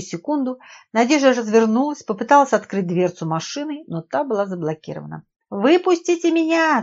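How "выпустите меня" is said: high, loud, drawn-out cry; screaming. A narrator's reading. Trapped woman to the driver, screaming. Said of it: "закричала женщина".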